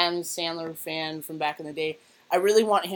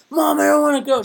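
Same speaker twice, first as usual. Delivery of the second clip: higher, louder whiny protest; loud teen whine; 10 semitones higher, 12 dB louder than usual. Retold or imitated. Teen refusing mom's early brunch, in a loud whiny protest. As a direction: complain loudly like a tired thirteen-year-old.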